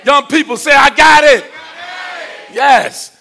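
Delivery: loud, high call to the crowd; rallying shout. Real, not acted. Preacher calling on young people to answer, rallying shout. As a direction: shout it to get them answering.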